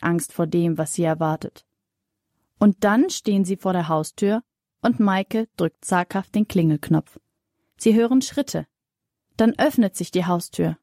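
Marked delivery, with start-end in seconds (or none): none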